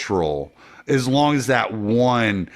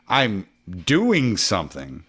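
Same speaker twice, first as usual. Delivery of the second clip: usual speaking voice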